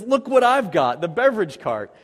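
high, loud excited call; excited find. Retold or imitated. Man on beach in commercial finding beer cart, excited. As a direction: shout out a lucky discovery. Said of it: "And he says".

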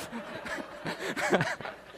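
breathy burst of real laughter at the story. Real, not acted. Laughs at gun threat story.

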